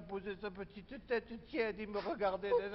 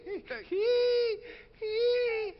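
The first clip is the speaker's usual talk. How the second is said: very high, loud drawn-out squeals; squealing animal imitation; 8 dB louder, much slower than usual. Man imitating his suffering pet in the garage, shrill loud squeals. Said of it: "il couinait".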